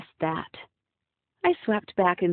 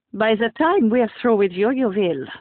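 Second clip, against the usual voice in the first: put-on loud, menacing Russian accent; deadpan threat in Russian accent; heavy Russian accent; 3 semitones higher, 6 dB louder than usual. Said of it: "in a heavy Russian accent".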